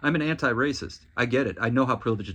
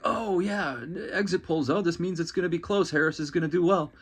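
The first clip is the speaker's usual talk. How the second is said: mock glowing anchor; 5 semitones higher than usual. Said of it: "there's almost this glow on the anchors".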